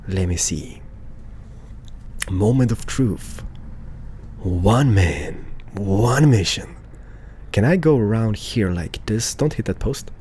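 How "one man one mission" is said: deep epic announcer narration; movie trailer voice. Pretend. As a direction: drop into a trailer voice. Dramatic self-narration while parking.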